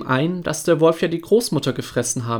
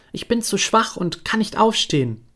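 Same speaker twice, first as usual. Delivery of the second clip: put-on much higher, thin old woman's voice; old grandmother voice; 8 semitones higher than usual. Sick grandmother asks who knocks, high frail old voice.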